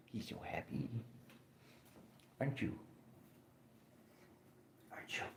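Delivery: soft voiceless whisper. Acted. Owner whispering to the happy cat. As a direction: breathe it to the cat.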